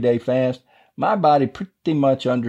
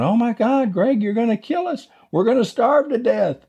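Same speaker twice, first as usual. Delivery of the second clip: much higher put-on voice, begging; voice of the starving body; 12 semitones higher than usual. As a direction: squeak it out as your own hungry body pleading for food. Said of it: "it doesn't talk like that".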